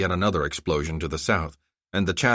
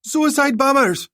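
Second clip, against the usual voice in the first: much higher, louder, slow alarm; panicked scream; 7 dB louder, slower than usual. Acted. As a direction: warn everyone in terror. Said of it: "someone screamed".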